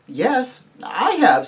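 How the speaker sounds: put-on high, light girl's reply; little girl. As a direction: answer up to the giant in a high voice. Girl answers the giant.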